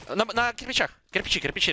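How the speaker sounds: high urgent shout of the spot; excited callout. Real, not acted. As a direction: yell the enemy's position fast. Calling out an enemy position.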